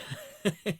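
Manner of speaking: quick bright laugh; short laugh. Real, not acted. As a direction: burst into a laugh. Laughs at his own story.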